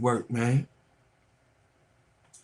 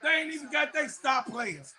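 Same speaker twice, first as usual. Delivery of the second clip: shouting at a rival fan; heated shouting; 13 semitones higher than usual. Real, not acted. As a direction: yell him down for bragging. Trash talking rival fan.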